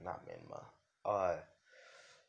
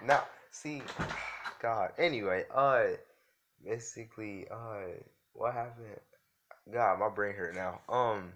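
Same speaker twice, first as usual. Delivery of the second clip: real exasperation; 4 semitones higher, 10 dB louder, slower than usual. Real, not acted. Flustered after a family member interrupts.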